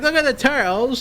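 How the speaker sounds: high-pitched mock Cockney child; Cockney kid. Imitating British kid at the zoo, Cockney accent. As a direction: imitate an amazed little Cockney boy. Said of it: "British kid who had a Cockney accent".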